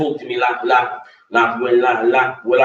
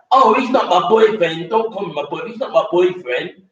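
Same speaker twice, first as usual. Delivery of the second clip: mocking girl's denial; 7 semitones higher than usual. [name] mocking the sister's boyfriend denial, higher mock whine. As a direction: ham up her defensive outburst.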